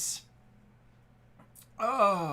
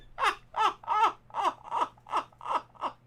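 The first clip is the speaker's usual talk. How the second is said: a long, high laugh that turns to sobbing; helpless tearful laughter; crying laugh; 14 semitones higher, 5 dB louder than usual. Real, not acted. Streamer helplessly laughing at the pull.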